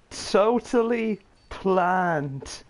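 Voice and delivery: slow, drawn-out fake confidence; overly slow sarcastic assurance; mock insistence. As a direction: stretch it out like you meant it all along. Sarcastic cover for a blunder.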